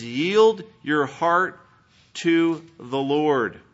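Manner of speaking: "Yield your heart" stretched very slowly and firmly; emphatic quoted command. Retold or imitated. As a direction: draw out each word of "Yield your heart to the Lord". Preacher stresses the quoted command.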